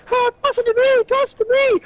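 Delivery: high-pitched mock child voice; imitated eager kids. Kids running upstairs to join the throwing game, imitated high and eager. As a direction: plead high like excited kids wanting in.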